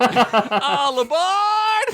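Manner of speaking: high stretched tiny reptoid shout; conductor shout. Acted. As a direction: call all aboard like a tiny conductor. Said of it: "shouted another tiny reptoid".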